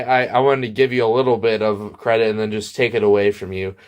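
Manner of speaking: ordinary delivery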